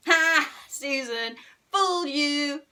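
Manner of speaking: loud, high gloating shout; triumphant taunt; gleeful shriek. A narrator's reading. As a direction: crow over the one you tricked. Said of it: "shrieked Henry".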